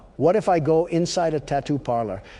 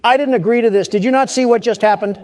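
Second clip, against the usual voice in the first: high, loud objection; indignant protest; 7 semitones higher, 6 dB louder than usual. Acted. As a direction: protest loudly at being roped in. Protesting being drafted by mascot.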